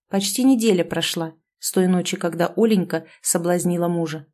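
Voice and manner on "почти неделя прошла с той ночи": usual speaking voice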